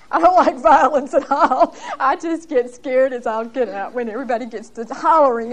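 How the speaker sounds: laughing through the words. Real, not acted. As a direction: chuckle at your own fear. Laughing at her own fear.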